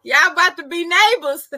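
high, loud, laughing hard. Real, not acted. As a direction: squeal it through laughter. Laughing at the news.

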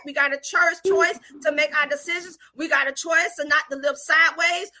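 insistent preaching built on repeated phrases. Real, not acted. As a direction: preach it urgently, rising to emphatic peaks. Preaches on the verse just read.

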